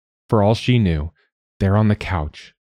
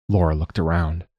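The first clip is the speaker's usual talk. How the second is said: ordinary delivery, nothing marked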